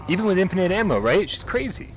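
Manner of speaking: high, loud exclaim; excited outburst. Real, not acted. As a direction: blurt out in disbelief. Reacting to enemy.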